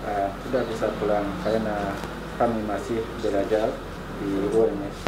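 Indonesian with a Ugandan accent; careful learner's Indonesian, Ugandan accent; foreigner speaking indonesian. Real, not acted. Ugandan student answering in Indonesian.

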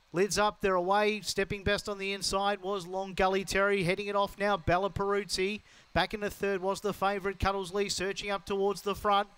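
fast, rising live race call; race caller. Real, not acted. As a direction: call the race as it unfolds, building.

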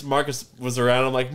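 ordinary talk

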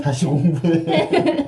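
slow teasing words through laughter; laughing. Real, not acted. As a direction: tease your friend while laughing. Teasing friend.

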